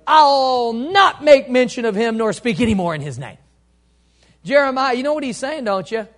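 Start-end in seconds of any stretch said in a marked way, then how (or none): 0.1-3.4 loud, high declaration; defiant outburst. Acted. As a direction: burst out with it after the pause. Jeremiah's defiant outburst, preached.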